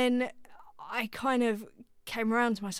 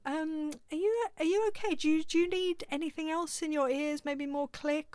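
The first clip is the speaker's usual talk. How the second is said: gentle producer; 7 semitones higher than usual. Retold or imitated. Producer asking over headphones.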